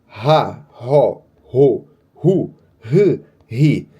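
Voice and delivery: ra, ro, ru, ri with a light breathy H; weak H demo. Shown as a demonstration. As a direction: say each with a faint H. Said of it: "não é um H muito forte".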